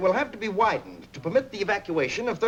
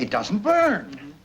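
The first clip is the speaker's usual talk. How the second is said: stunned disbelief; 3 dB louder, much slower than usual. Acted.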